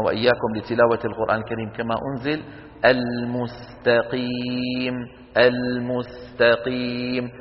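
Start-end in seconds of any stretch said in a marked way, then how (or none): 2.9-7.3 المستقيم chanted slowly, س kept light against the heavy ق; correct light seen. Shown as a demonstration. Teacher modelling the correct recitation slowly. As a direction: slowly intone المستقيم with a thin س. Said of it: "فالقاف مفخمة نعم".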